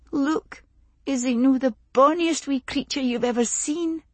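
put-on young woman's voice. Lizzie shows off her baby.

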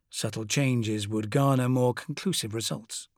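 plain reading voice

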